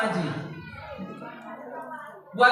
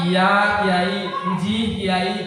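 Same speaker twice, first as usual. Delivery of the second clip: mock fawning congregant; 6 semitones lower, much slower than usual. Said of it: "ketemunya sama iya depan ulama".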